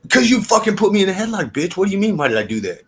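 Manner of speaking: high, loud, indignant snap back; indignant retort. Retold or imitated. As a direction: fire it back like it's obvious. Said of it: "And I'm thinking".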